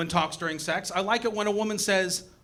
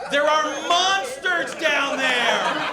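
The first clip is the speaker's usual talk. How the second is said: mock horror; 7 semitones higher, slower than usual. Mock horror at sea creatures.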